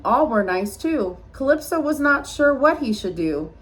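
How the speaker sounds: ordinary delivery, nothing marked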